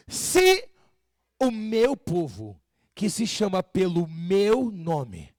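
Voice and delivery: drawn-out, emphatic preacher's quotation; scripture proclamation. Acted. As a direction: declaim the verse slowly, word by word.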